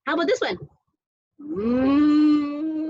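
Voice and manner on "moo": long, swelling cow moo. Pretend. Parent showing a toy cow, long playful moo.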